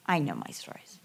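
under your breath, self-mocking; a wry whispered joke at her own slip; whispered aside. Real, not acted. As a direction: whisper it to yourself. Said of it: "self-mocking aside".

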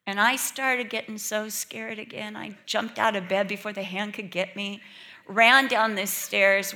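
ordinary talk

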